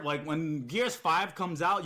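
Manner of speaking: normal talking voice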